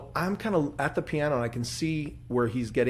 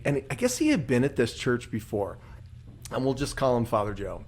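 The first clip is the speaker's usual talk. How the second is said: ordinary delivery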